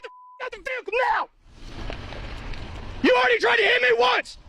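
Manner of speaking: high, desperate yelling command; panicked shout. Real, not acted. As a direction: scream the warning at the driver. Guard yells at driver, gun drawn.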